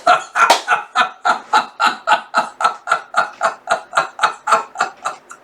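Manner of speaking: long wheezing, breathy giggle fit; helpless high, voiceless laugh; real laughter. Real, not acted. Laughing at the bad apple line, real wheezy laughter.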